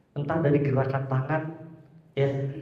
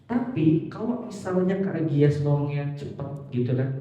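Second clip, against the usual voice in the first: ordinary talk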